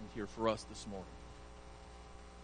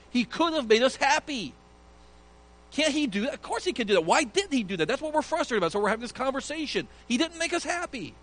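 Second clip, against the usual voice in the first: high, raised whining complaint; frustrated crowd; 10 semitones higher, 4 dB louder than usual. Acted. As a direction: grumble to each other about Jesus.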